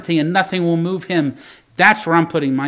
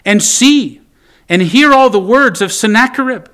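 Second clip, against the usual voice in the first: pleading prayer; 6 semitones higher, 7 dB louder than usual. Retold or imitated. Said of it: "He continues his prayer".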